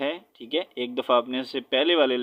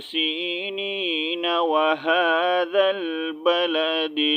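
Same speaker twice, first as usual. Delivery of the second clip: verses run together in one breath, higher and slow; joined recitation; 4 semitones higher, much slower than usual. Shown as a demonstration. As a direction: recite without stopping, linking each verse. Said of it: "تو کیسے پڑھوں گا؟". (ملا کر ایک سانس میں تلاوت)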